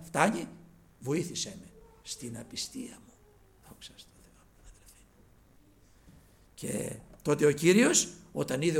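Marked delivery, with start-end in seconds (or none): 3.7-5.0 soft breathless whisper; whispered praise. Real, not acted. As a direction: whisper it in awe. (ψιθυριστά)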